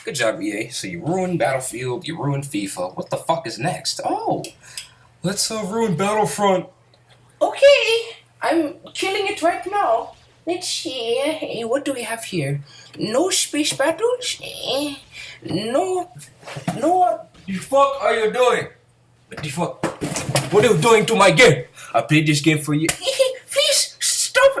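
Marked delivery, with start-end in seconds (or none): 4.0-17.3 high, gleeful villain voice; smug higher cartoon corporate voice; mock EA exec. EA boss gloating over ruining the game. 17.5-24.5 pleading gamer, pitched up; mock upset fan. Fan begging EA to stop wrecking his game.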